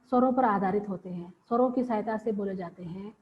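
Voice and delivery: ordinary talk, nothing marked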